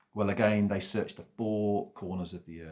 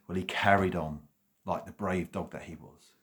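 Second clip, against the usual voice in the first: ordinary delivery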